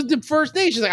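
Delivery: ordinary delivery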